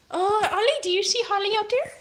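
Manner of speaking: high, quick coo at the cat; high cat talk. Real, not acted. Owner asking her cat in a high, quick coo. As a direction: ask the cat sweetly, up high.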